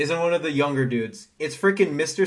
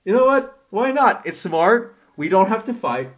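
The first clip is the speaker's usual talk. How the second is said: higher, louder, eager approval; mock eager agreement; 6 semitones higher, 5 dB louder than usual. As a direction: sell the dumb plan with enthusiasm. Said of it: "I was like".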